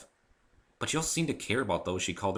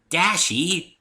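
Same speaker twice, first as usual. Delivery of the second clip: much higher, bouncy call of a nickname; bubbly Pinkie voice; 10 semitones higher, 8 dB louder than usual. Acted. Pinkie calling Rainbow by a cute nickname, high and bouncy. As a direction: sing out the nickname.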